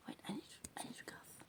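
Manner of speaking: usual speaking voice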